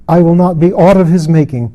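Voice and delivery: high, loud, defiant anguish; tormented proud queen. Acted. Lilith defies her maker before Mara, loud and anguished. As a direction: hurl every word at heaven, raging and desperate.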